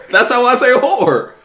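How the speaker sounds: "horror" as one syllable, "hore", high and loud; swallowed word ending. Real, not acted. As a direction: defend saying "horror" without its ending. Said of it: "enunciate the last part of the word".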